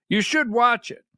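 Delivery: ordinary talk, nothing marked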